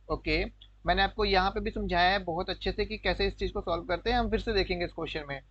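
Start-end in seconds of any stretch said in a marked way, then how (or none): none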